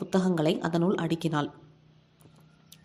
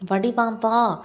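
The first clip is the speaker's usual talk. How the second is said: frenzied shout; 3 semitones higher, 4 dB louder, much slower than usual. A narrator's reading. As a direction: scream the order at the daughter. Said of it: "Sornam ஆவேசம் வந்தவள் போல் அலற". (ஆவேசமாக அலறி உள்ளே போகச் சொல்லுதல்)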